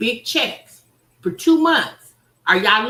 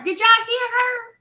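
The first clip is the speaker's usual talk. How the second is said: high, loud, thrilled call; excited call to chat; 11 semitones higher, 5 dB louder than usual. Real, not acted. Streamer shouting to chat about a commenter, high and excited. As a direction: rile up the viewers.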